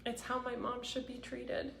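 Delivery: high, choked-up voice with long pauses; really fighting tears while speaking of family; emotional, near tears. Real, not acted. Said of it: "this is making me so emotional".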